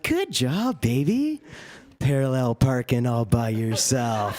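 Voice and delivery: put-on stay-at-home dad using toddler praise on his wife; phony encouragement voice. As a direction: praise her like a toddler who parked. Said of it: "phony encouragement voice".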